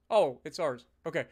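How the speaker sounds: normal talking voice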